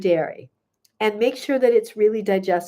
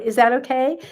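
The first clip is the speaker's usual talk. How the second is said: ordinary talk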